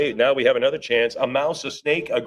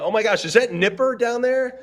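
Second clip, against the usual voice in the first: much higher, thrilled discovery; light up at spotting the dog; delighted surprise; 9 semitones higher than usual. Real, not acted. Spots a favourite poster.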